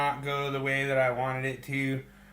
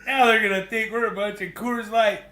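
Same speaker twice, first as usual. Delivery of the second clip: higher, louder fretting father; exasperated dad; 6 semitones higher, 6 dB louder than usual. Retold or imitated. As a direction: grumble in embarrassment about the neighbours. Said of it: "your dad was just like".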